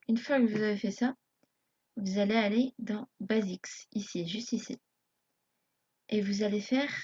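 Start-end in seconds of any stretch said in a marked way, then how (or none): none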